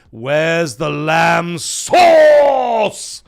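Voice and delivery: very slow build into a huge bellow; loud, stretched mock rage shout; Gordon Ramsay freak-out. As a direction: drag it out, exploding on the last word. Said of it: "straight to eleven".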